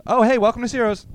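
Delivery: mock cashier greeting. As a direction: play the cheery cashier. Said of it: "I'll be you".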